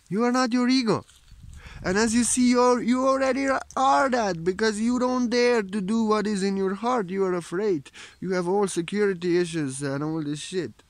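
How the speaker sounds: high, loud, ringing preacher's call; fervent preaching. Acted. Spiritual teacher rousing viewers to leave ego behind, in fervent preaching. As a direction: proclaim it to the whole hall.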